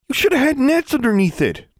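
put-on high, loud boy's voice insisting; insistent boy. A narrator's reading. Boy blaming the teacher after nearly falling, insistent. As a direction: press the point hard, shaken. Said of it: "David insisted".

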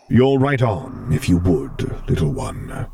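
a hint of a gentle stern lord; Vader voice.

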